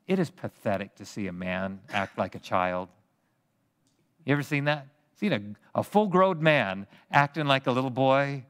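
ordinary talk, nothing marked

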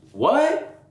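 high, stunned disbelieving what; a sudden jump up in pitch, caught off guard; shocked what. Retold or imitated. Father hearing his son drowned, shocked disbelief. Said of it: "I'm like".

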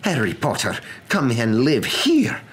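high, loud, indignant protest; crying out in protest. A narrator's reading. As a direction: protest in horror, pitched high and emphatic. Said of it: "cried Professor McGonagall".

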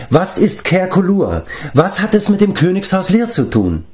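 high, pressing questions; urgent questioning. A narrator's reading. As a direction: interrupt with insistent questions, pitched well up. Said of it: "unterbrach ihn Taran". (drängend nachfragend)

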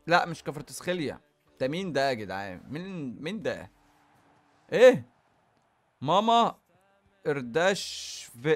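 ordinary delivery

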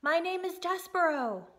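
shouted back. A narrator's reading. Mouse calling his name back to princess, shouted. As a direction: call out proudly from the wall. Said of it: "shouted back to the princess".

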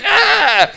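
short scream, very high; mock scream. Comic telling tweakers found in a crusher, mock scream. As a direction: yelp it out suddenly, high.

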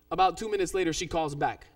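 normal talking voice

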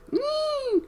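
very high squeaked no. Acted. Gnome squeaking his refusal to the prince. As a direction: squeak it out in alarm. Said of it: "squeaked Gold".

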